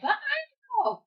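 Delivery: very high mock-reluctant protest; exaggerated high-pitched reluctance; reluctant whine. Mimics own reluctant reply to boyfriend.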